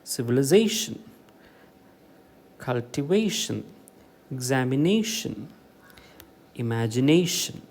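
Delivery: Civilisation, cultivation, examination, imagination, each stressed just before the final "shun"; word list stressing the second-last syllable of civilisation, cultivation, examination, imagination. Shown as a demonstration. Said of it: "second last syllable".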